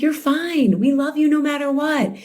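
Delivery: high-pitched, brushing-off comfort; mock reassurance. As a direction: imitate a relative insisting all is fine. Voicing sister's dismissive reassurance.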